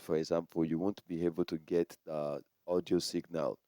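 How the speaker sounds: barely coming through the mic; record level turned down. Shown as a demonstration. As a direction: voice sunk down in the recording. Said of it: "If you turn down the record".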